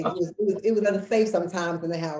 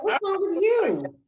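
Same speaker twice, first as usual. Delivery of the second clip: mock alarm; 6 dB louder than usual. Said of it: "I was like".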